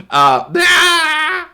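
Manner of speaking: high laughing yelp of fright; a quick cartoon shriek, half laughing; mock scream. Reacting to the animation, a high mock shriek.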